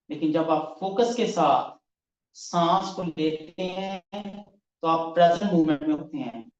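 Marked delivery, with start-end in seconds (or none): none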